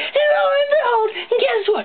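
ordinary delivery

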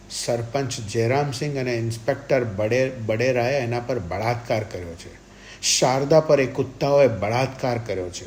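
loud, anguished accusation; outraged. A narrator's reading. Grieving husband accuses his wife's attackers, outraged. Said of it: "જોર જોરથી ગાળો બોલતો રહ્યો".

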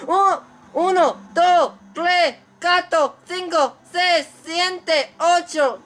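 counting uno to ocho up high like the ring referee; referee count in Spanish. Retold or imitated. Gamer mimics the boxing referee counting the downed opponent, high count. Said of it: "I probably said that wrong".

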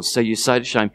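ordinary talk

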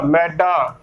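ਮੈਡਾ with the open "ai" vowel, as in "mɛɖa"; correct pronunciation. Shown as a demonstration. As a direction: say ਮੈਡਾ with a broad "æ", not "e". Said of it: "ਇਸ ਦਾ ਉਚਾਰਨ ਇਸੇ ਤਰ੍ਹਾਂ ਹੀ ਕਰਨਾ ਹੈ".

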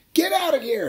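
high, loud shout to chase someone off; mock angry shout. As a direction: bark them away. Men chase off the bird.

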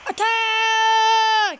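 loud drawn-out war shout; battle cry. Acted. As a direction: yell the charge to the army.

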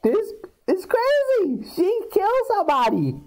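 hammed-up high shock; shocked realization. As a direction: act out horrified discovery, voice shooting way up. Voices Suzanne's shocked realization.

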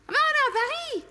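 high, sarcastic cry; wife scoffing at husband's fears; mocking exclamation. Wife mocks his fears.

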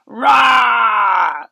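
a lion's roar from the speech bubble. Acted. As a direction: roar like a cartoon lion.

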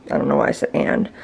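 normal talking voice

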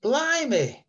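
high mild exclamation of surprise; lightly imitated surprise; surprised exclamation. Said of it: "my dad said".

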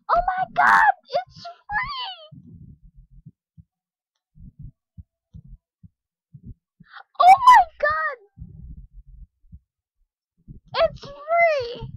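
very high, loud screech of joy; ecstatic screaming. Real, not acted. Player screaming over free items, ecstatic. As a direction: scream it at the top of your voice, overjoyed.

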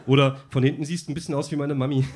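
normal talking voice

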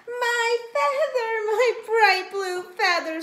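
slow, high, loud wail; drawn-out, tearful rooster squawk. A narrator's reading. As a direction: squawk in anguish over a loss. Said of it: "squawked Chicken Chuck".

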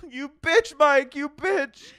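high, loud laughing hammed-up curse; mock outrage. Host mocking a fan's name, high loud mock curse. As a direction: curse the fan in fake fury.

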